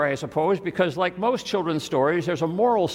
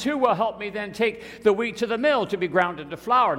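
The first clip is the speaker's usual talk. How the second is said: higher-pitched, lilting question; storybook hen asking; 7 semitones higher than usual. A narrator's reading. Little red hen asks for help at the mill, high storybook voice. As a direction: lift it up as for children.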